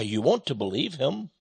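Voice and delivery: ordinary talk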